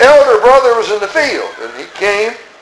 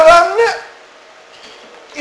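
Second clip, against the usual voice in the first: whiny elder brother; 12 semitones higher than usual. Acted. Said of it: "He said".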